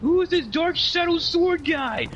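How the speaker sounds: high, squeaky mock-whiny voice; mocking opponent's rant. Mimicking the angry opponent mid-match, high and whiny. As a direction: imitate the ranting opponent in a high whine. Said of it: "going on this rant going".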